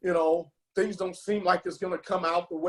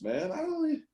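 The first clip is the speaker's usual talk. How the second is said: words cut off as feeling wells up; choked up; 11 semitones higher, 4 dB quieter than usual. Real, not acted. Host overcome while praising a team member, choked up. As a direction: voice catching, rising high and thin with emotion. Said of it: "I'm getting emotional talking about this".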